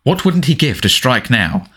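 usual speaking voice

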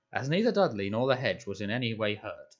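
usual speaking voice

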